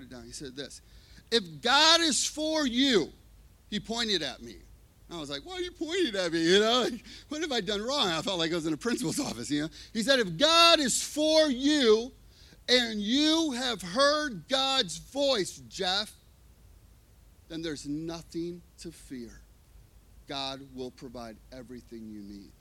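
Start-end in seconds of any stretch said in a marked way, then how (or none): 1.3-3.1 high, loud, slow emphatic words; emphatic declaration. Retold or imitated. Pastor's pointed declaration. 5.4-8.1 high, loud startled question; alarmed. Retold or imitated. His alarmed reaction to pointing. 10.2-16.1 very slow, loud, high and deliberate; slow emphatic declaration. Retold or imitated. 17.5-22.5 calm reassurance. Retold or imitated.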